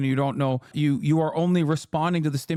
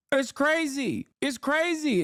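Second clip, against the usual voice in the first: ordinary talk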